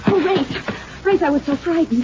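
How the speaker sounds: high, breathy put-on relief with a laugh; fake fright. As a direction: overplay being frightened and relieved. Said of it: "Yeah, you're so sincere".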